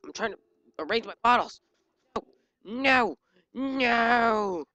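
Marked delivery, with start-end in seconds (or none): 2.1-4.6 long, raised wail of no; loud drawn-out noes; drawn-out protest. Real, not acted. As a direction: stretch each no out, higher. Protesting when hit mid-game.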